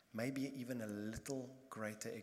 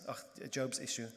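ordinary talk, nothing marked

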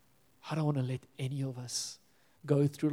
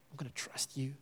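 whisper; 10 dB quieter than usual. Pretend. Whispered prayer of surrender.

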